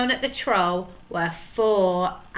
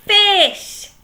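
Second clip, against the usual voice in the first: loud; 12 semitones higher, 10 dB louder than usual. Acted. Pirate chief answering emphatically.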